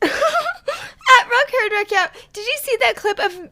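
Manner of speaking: high laughing read; cackling fan. Acted. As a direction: giggle through the handle. Laughing poster.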